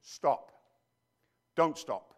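ordinary talk

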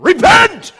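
shouted high repent, hammed-up; mock prophet cry. Street preacher in camel hair crying out to crowd, hammed-up shout. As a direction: yell it like a wild desert prophet.

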